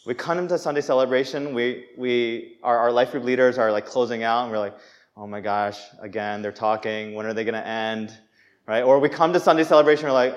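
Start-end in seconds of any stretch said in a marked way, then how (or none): 5.1-8.2 low, soft, bored grumble; tuned-out inner complaint, put on; acted boredom. Life group member as leaders close out, acted boredom. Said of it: "we tune out".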